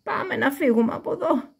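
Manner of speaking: wolf urges escape; wolf urging. A narrator's reading. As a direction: get the hen out quickly. Wolf leads hen away.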